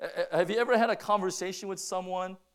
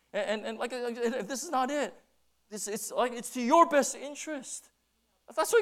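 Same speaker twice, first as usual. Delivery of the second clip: normal talking voice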